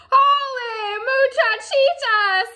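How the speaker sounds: usual speaking voice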